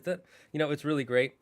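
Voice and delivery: ordinary talk, nothing marked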